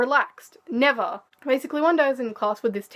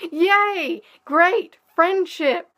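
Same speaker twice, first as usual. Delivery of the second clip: bright, higher mock celebration; sarcastic cheer; 6 semitones higher, 4 dB louder, much slower than usual. Mocking her classmates' coldness with sarcastic cheer. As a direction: fake enthusiasm dripping with sarcasm.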